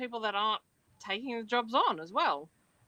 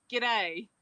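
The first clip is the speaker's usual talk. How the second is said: Australian accent model. Shown as a demonstration. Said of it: "give us an expression or a phrase".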